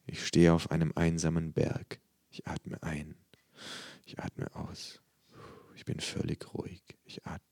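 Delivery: very soft, fading relaxation mantra; murmured mantra. A narrator's reading. Patient dozing off during exercise, fading murmur. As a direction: drift from a murmur to nearly nothing. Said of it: "murmel ich".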